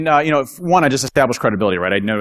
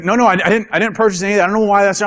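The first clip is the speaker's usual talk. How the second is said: higher-pitched, stammering protest; retold flustered denial; 9 semitones higher, 4 dB louder, faster than usual. Bob denying embarrassing charges to the caller, mock flustered. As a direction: imitate someone hastily denying it.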